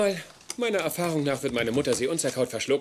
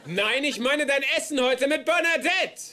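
calling out; 10 semitones higher than usual. Real, not acted. Ruft erklärend durchs Haus. (ruft langsam und laut durch die Tür zur Mutter)

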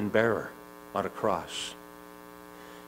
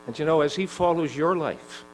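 higher and louder, building fervor; preaching at full force; 6 semitones higher, 11 dB louder than usual. Acted. Preacher pleading with the listener, rising to full force. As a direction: drive it home to the congregation.

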